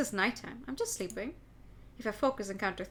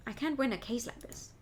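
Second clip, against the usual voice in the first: usual speaking voice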